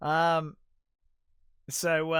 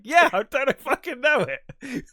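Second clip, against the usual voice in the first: laughing quote; 10 semitones higher, 7 dB louder, slower than usual. Retold or imitated. Randall's deadpan retort retold.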